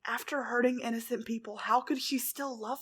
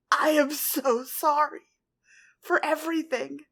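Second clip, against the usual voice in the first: weeping; 4 semitones higher, 7 dB louder than usual. A narrator's reading. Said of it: "full on weeping now".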